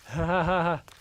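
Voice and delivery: loud mad laugh; manic laugh. Acted. Creature runs off into the dark, laughing madly. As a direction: cackle as you flee. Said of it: "runs off into the darkness laughing".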